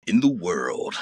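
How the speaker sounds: low, punchy movie-trailer narrator; deep trailer voice. Retold or imitated. Recalling the guy on cinema trailers, deep announcer imitation. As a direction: intone it like a film trailer opening. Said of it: "like, really deep voice talk".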